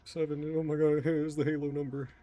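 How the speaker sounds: excited announcer call. Real, not acted. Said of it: "I don't know why I said it like that".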